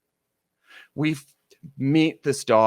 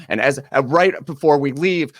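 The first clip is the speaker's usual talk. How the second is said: normal talking voice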